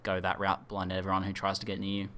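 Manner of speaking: normal talking voice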